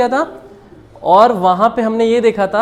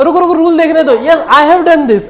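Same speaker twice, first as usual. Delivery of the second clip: higher, louder mock-panicked learner with a laugh; flustered learner; 6 semitones higher, 5 dB louder, slower than usual. Learner stops a conversation to check the rule, mock flustered. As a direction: play someone halting a chat, half laughing. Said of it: "किसी से बात करोगे तो".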